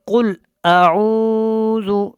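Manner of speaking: drawn-out chanted tajweed recitation; slow Quran recitation. Shown as a demonstration. Tajweed teacher demonstrating recitation to students, slow chant. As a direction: intone each word slowly, melodically.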